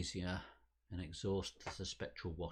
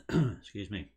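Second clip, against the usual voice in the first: clearing the throat; 2 semitones higher, 11 dB louder than usual. Acted. Speech interrupted by acted throat clearing.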